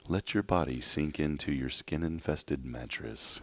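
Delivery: slow, soft mock meditation; joking spa guide voice; soft spa whisper. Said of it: "one of those meditation episodes".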